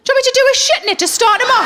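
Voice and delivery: put-on chirpy helpful boyfriend, rushed; imitating fella. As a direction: fella keenly offering to help the kitten. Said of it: "he looked down at the litter tray and he went".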